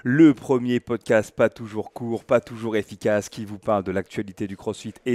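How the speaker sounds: loud, drawn-out show opener; booming, slow-rolling welcome to listeners; podcast host intro. Acted. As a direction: kick off the show big, stretching the words.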